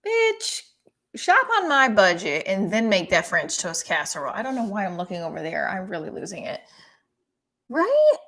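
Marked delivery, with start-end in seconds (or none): 0.0-4.3 loud, fed-up comic rant; exasperated outburst. Real, not acted. Ranting at the celebrity. 7.7-8.2 Right squealed very high and loud; very high, loud squealed rising question; shrieked right. Real, not acted. Seeking agreement, comic peak.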